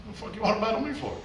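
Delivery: imitated fast, high-pitched objection; baffled retort. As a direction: blurt it out in puzzled disbelief. Voicing baffled reply to challenge.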